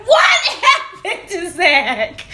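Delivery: loud, drawn-out shocked cry; retold alarmed shout. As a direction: act out shouting in alarm at the news. Alarmed reaction to phone call, retold.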